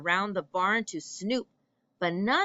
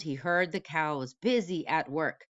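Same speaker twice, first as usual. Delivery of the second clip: plain reading voice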